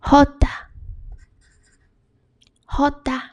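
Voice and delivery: "jota" as HO-ta with breathy H; Spanish J name. Shown as a demonstration. Teacher naming the letter J, breathy HO-ta. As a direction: start "jota" on a strong H sound. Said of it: "The letter is pronounced as".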